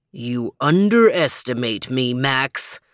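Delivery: low and resolute; deep dog voice. Acted. Overton resolves.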